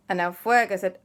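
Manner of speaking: plain reading voice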